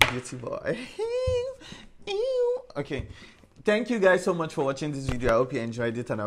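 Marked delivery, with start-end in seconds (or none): 0.8-2.7 squealing high laugh. Real, not acted. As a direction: burst into a giggle. Laughs after beauty boy.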